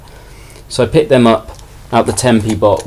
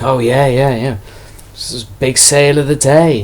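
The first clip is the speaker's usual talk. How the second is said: imitated scoffing stallholder, a touch higher; mock-agree, then deliver the retold dig; sarcastic; 4 semitones higher than usual. Stallholder scoffing at a tiny sale, sarcastic.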